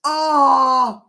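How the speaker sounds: a loud overjoyed shout; joyful cry. Real, not acted. Streamer thrilled at fifty subscribers, joyful cry.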